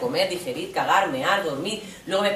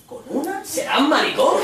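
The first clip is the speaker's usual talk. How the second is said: high, incredulous mock jeer; mocking lad; 5 semitones higher than usual. Teen mocking his friend's inexperience, incredulous jeer. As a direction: scoff in disbelief.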